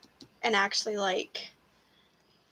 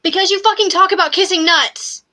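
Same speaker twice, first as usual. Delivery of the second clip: loud, much higher exasperated yell; shouting; 9 semitones higher, 15 dB louder than usual. Real, not acted. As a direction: blast it back at him. Outburst at friend over lost follower.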